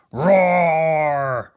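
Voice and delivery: loud, drawn-out bear roar; big grumpy bear bellow. Acted. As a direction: roar to scare the goslings off. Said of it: "became extra grumpy".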